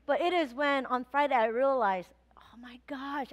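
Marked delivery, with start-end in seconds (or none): 2.4-3.3 hushed gasp of realization; whispered realization. Retold or imitated. Pastor recalling her realization, whispered. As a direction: breathe it as it dawns on you.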